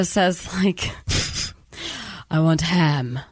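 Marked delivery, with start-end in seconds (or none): 2.3-3.2 imitating an Eastern European accent, low; a low mock Eastern European line. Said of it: "weird Eastern European accent".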